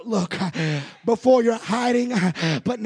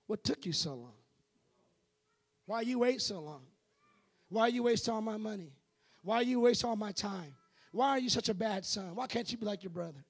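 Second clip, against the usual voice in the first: a hint of a nagging, scolding dad, softer; imitating a scolding father; 12 dB quieter, faster than usual. Father scolding the lost son on his return. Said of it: "The first thing he said was not".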